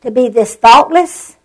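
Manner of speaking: slow, indignant inner voice; mild retold fury at the pastor's board; remembered anger. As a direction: drag out the hurt, restrained outrage. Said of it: "It made me so mad".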